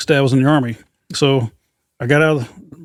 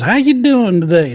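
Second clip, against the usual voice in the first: high, slow sweet Southern lady; imitating a friendly Alabama store clerk; friendly old lady; 7 semitones higher, slower than usual. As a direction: drawl it out like a chatty old lady.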